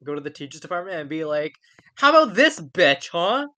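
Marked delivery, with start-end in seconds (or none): 1.9-3.5 loud, fast, higher jeering rant; mock confrontation. Friend imagining confronting the teachers department, jeering loudly. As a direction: jeer it at the department.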